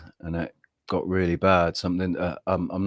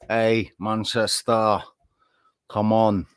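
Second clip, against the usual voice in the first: Manchester accent attempt; 3 semitones higher, 4 dB louder, slower than usual. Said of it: "I can't do a Manchester accent".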